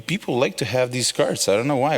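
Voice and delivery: normal talking voice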